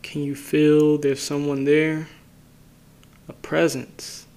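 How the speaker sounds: unhurried phrases, each left to settle in silence; guided meditation. Acted. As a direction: guide listeners inward calmly, leaving space after each phrase.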